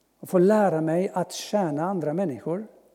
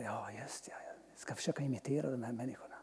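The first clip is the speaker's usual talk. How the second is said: soft inner whisper; whispered thought; 4 semitones lower, 15 dB quieter than usual. Retold or imitated. Deciding to imitate these people, whispered to himself. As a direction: whisper it as a private resolve. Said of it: "Jag tänkte".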